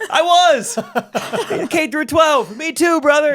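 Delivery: very high, loud imitation of an excited guy; overjoyed bonding. Homeschooled guy bonds with a new friend, overjoyed. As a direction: burst out in a hammed-up high-pitched cry of kinship.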